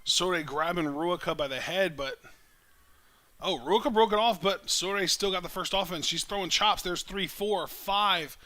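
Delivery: lively live play-by-play call; wrestling commentator. Real, not acted. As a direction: call the match as it happens.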